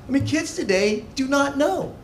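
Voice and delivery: usual speaking voice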